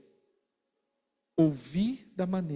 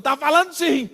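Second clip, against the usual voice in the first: higher and louder, a hammed-up accusing snap; mock accusation; 8 semitones higher, 8 dB louder than usual. One side of a couple's argument, insisting in mock anger. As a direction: throw it back at them like a petty quarrel.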